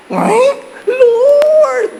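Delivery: high loud squeaky wail with a laugh; car crying out. Retold or imitated. Second sack loaded, car wails high and loud.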